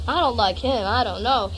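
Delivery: low, soft voiced cat; wary cat voice. Cat Romeo doubting the man with food, reluctant.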